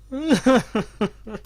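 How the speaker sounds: helpless little laughing fit. Real, not acted. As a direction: burst into laughter at a silly name. Laughing at a player's name.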